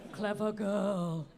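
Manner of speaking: low, very slow menacing movie line; Jurassic Park quote. Retold or imitated. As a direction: say it like the wary hunter eyeing the raptor.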